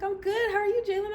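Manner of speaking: high, bright, chirpy answer; imitating friend's chirpy reply. The friend answering Jalen in a high, chirpy voice. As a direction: pitch it up, chipper and friendly. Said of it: "She's like".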